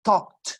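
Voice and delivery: "Talked" with a hard, spat-out T at the end; "Talked" ends in a sharp burst of T; explosive final T. Shown as a demonstration. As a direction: spit the final T of "Talked" loudly. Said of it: "así como escupiendo".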